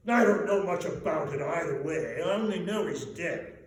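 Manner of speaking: loud, high blustering merchant; great fat man. A narrator's reading. Fat businessman dismissing the talk, loud blustering voice. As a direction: bark it out like a stout businessman. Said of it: "said a great fat man with a monstrous chin".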